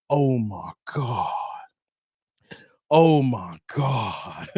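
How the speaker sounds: low, slow, drawn-out imitation; Deontay Wilder. Host imitating a boxer's reaction, low slow mock astonishment. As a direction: drop it deep and drag it out. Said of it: "Like Deontay Wilder".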